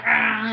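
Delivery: loud, high imitated "ah" of pain; mock pained cry; retold pained cry. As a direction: cry out as if hurt.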